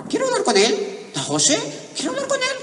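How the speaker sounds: put-on very high, loud child's voice; insistent kids. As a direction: beg like an insistent little kid. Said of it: "los muchachitos".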